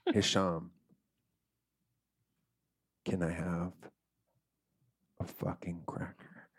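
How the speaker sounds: slow, low request with long pauses; weak, starving plea. Acted. Starving storyteller asking Hisham for a cracker, weak plea. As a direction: let it fade to very soft, word by word.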